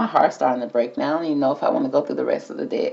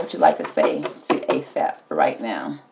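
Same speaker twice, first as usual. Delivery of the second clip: much lower and slower, drawn out; coaxing low voice; 12 semitones lower, 4 dB quieter than usual. Pretend. Reader coaxes the card to speak, low and slow. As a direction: coax the answer out slowly and low.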